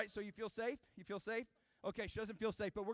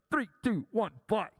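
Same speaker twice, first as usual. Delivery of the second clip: loud launch count, word by word; imitated operator's shout; yelled countdown; 12 dB louder than usual. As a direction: bellow it like a ride operator. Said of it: "this guy's up there yelling this".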